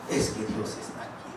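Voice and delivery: put-on a small child's hushed, breathy answer; child's whisper. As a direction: whisper it like an awed little kid. Child answers pastor in story.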